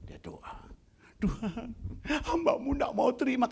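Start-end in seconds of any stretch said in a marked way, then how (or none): 1.2-3.5 put-on higher, whiny childish plea; man complaining to God like a child; childlike prayer. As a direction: pray like a sulking child. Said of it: "Kayak anak kecil ngomongnya".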